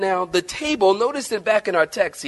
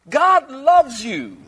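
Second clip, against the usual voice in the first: slow, drawn-out loving assurance; slow earnest reassurance; tender emphasis; 7 semitones higher, 4 dB louder, much slower than usual. Real, not acted. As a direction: press love into each word. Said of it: "tender emphasis".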